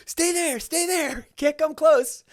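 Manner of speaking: high, urgent calls to keep back; anxious warning. Retold or imitated. Imitating David keeping fans away in covid, high and urgent. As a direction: wave them off, urgent. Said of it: "he'd be like".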